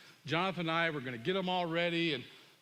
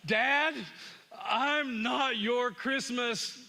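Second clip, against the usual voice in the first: loud, high opening, then slow emphatic words; retold furious outburst; 6 semitones higher, 4 dB louder, much slower than usual. Son with clenched fists defies his father, furious. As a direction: act out a son at breaking point. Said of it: "his knuckles clench up so tight".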